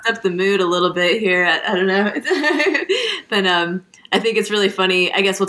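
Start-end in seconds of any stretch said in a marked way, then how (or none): 2.2-3.2 high laugh. Real, not acted. Amused at mood shift.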